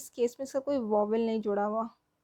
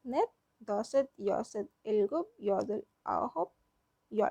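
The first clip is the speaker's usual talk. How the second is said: slow, deliberate count with a rise on each pair; slow recitation; 2 semitones lower than usual. Shown as a demonstration. Teacher to students, reciting Korean numbers slowly for practice. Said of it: "क्या था?".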